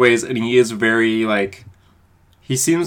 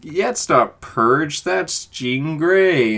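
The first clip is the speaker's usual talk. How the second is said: slow, drawn-out playful quote; mock knowing recognition; 4 semitones higher, much slower than usual. Reader spotting a Marvel lookalike, mock knowing. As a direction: stretch it out like spotting a thin disguise.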